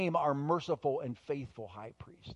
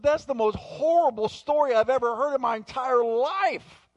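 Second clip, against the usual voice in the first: high, loud, overwrought exclamation; retold distraught outburst; 9 semitones higher, 6 dB louder than usual. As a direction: act out a friend overcome by horror. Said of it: "her friend was sobbing".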